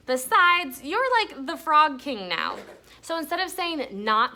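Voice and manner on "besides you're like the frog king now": praising. A narrator's reading. Judy praising Stink.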